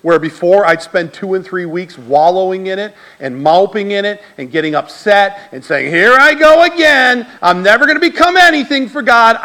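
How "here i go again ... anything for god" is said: mock self-pity. Retelling his old self-pity.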